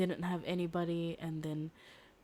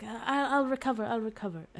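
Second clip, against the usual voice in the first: mock brave shrug-off; 5 semitones higher, 4 dB louder than usual. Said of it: "And he's like".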